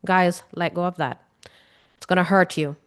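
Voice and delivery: ordinary talk, nothing marked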